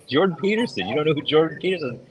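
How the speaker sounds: high-pitched, incredulous retort; quickening, high mock astonishment; acted disbelief. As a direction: imitate someone amazed you don't know.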